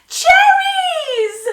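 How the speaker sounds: very high, loud shout of delight; joyful shout. A narrator's reading. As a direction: shout with joy at last. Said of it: "shouted Paul".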